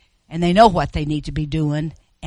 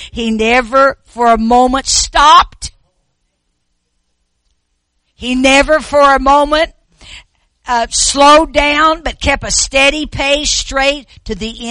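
preaching; 7 semitones higher, 11 dB louder than usual. Real, not acted. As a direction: hammer each phrase home. Preaching at full force.

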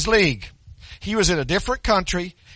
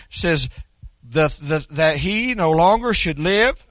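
normal talking voice

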